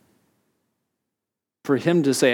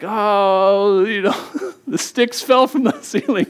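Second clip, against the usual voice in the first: put-on high, laughing kid voice; a child's giggly fib; kid's excuse; 8 semitones higher, 4 dB louder, slower than usual. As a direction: play the son lying his way out. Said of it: "He's like".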